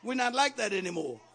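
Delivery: loud, high, shouted preaching; preaching at full force. Real, not acted. Preacher shouting to his congregation about talking back to yourself, at full force. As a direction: drive it home to the congregation, full voice.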